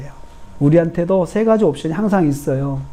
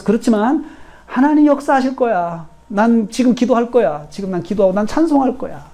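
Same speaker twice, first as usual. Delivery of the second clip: raised-pitch, resolute declaration; 믿음의 선포; 7 semitones higher than usual. Shown as a demonstration. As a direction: lift the voice and declare your resolve. 믿음의 선포를 시범으로 보임. (높인 목소리로 다짐하듯 선포)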